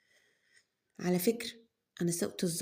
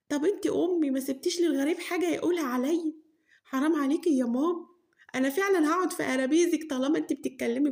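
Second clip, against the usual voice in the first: pleading daughter; 6 semitones higher than usual. Acted. Daughter pleads with scolding mother.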